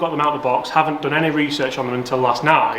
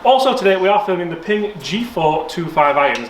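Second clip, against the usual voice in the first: presenter voice; 3 semitones higher than usual. Acted. Retake completed.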